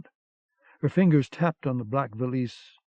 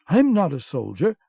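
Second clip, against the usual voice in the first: protesting; 5 semitones higher, 7 dB louder than usual. A narrator's reading. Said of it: "Mouse protested".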